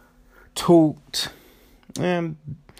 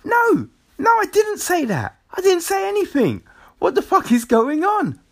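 high, loud mock-panicked denial; acted indignant protest; 4 dB louder than usual. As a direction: protest your innocence as if everyone is staring. Acts out protesting a public embarrassment.

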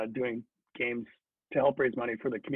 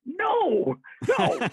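much higher, laughing emphatic refusal; 7 dB louder than usual. Retold or imitated. Teammate insists on winning now, laughing emphatic no. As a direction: bark it up high, half laughing. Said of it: "He's like".

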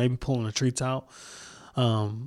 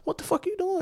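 much higher and softer, squeaky through laughter; laughing mock yell; 6 dB quieter than usual. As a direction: cackle it at someone on the ground. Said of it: "I'd have been cracking the fuck up".

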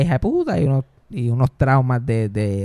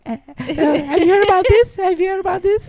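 put-on very high little-kid stand-up voice; four-year-old comedian; 5 dB louder than usual. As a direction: parody a tiny child doing stand-up. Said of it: "yo tenía como cuatro años". (niño de cuatro años, vocecita muy aguda)

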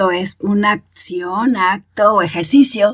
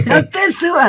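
much higher mock shout at the puppy; mock yell at the dog; 8 semitones higher than usual. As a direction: yell it up high like losing patience. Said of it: "le gritas".

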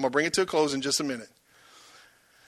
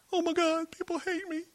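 mock whine; 12 semitones higher, 8 dB quieter than usual. Mock wail of hurt believer.

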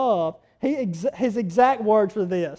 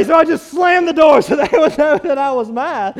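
wife laughing; 4 dB louder than usual. Retold or imitated. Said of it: "And Catherine goes".

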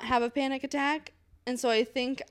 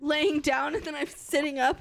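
ordinary talk, nothing marked

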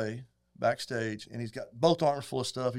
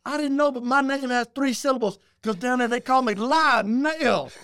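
[name], excited; 11 semitones higher, 5 dB louder than usual. Retold or imitated. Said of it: "He goes".

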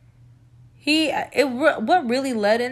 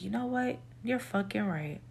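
much softer, resigned retort; quiet concession; 3 semitones lower, 11 dB quieter than usual. Retold or imitated. Conceding to the guy on the phone, very quietly. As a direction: drop right down and concede under the voice. Retold giving in during argument.

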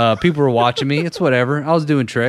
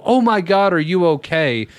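acted alarm; 6 semitones higher than usual. Acting out his alarmed concern.

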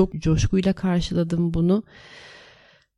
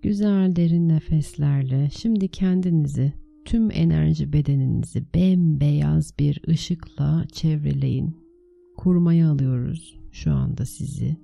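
guided meditation; 3 dB louder than usual. Acted. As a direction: lead them calmly into the meditation. (sakin rehberli meditasyon sesi)

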